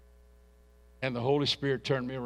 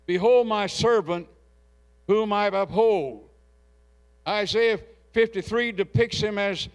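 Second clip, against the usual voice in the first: raised, higher voice declaiming the verses; preached scripture reading; 6 semitones higher, 4 dB louder than usual. Acted. Pastor reading Isaiah aloud to the congregation, declaiming. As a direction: proclaim the verses over the church.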